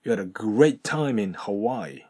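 "had a" as "hada", "time in" as "taimin", slow; slow linked read. Shown as a demonstration. Teacher reads slowly, every joint linked. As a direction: link each joint, slowly. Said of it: "こんな感じですね".

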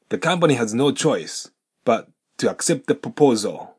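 "but to" and "accept the" linked throughout; full linked read. Shown as a demonstration. Teacher runs the whole sentence linked. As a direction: read it through with all links. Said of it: "もう一度通して発音すると".

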